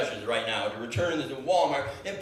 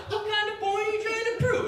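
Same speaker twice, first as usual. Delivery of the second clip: imagined clerk. Pretend.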